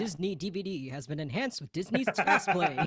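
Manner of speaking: low, deep, announcer-style read; DVD announcer voice. Retold or imitated. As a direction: intone it like a movie disc intro. Mimicking DVD intro announcer.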